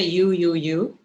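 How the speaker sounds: blaming you. Shown as a demonstration. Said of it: "But if you say".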